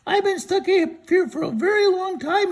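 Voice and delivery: high, loud cartoon scarecrow; storyteller voicing a high silly scarecrow. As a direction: pitch way up and call out as the scarecrow. Scarecrow stuck on a pole.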